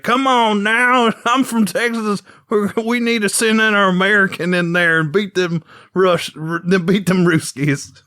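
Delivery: hammed-up Texan rallying his side; Tex Conway. Host imitates the Texan mentor, hammed-up Texas accent. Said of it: "he's just talking like this".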